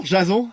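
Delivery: "Jason" said the French way, zha-ZON; "Jason" with a French J and a nasal ON ending; "Jason" pronounced /ʒazɔ̃/, not American; French-style Jason. Acted. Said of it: "ça se prononce plutôt Jason, comme à l'américaine".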